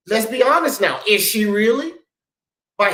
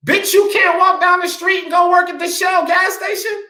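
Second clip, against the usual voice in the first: ranting shout; 9 semitones higher than usual. Real, not acted. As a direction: shout it down at them in outrage. Ranting about women in projects.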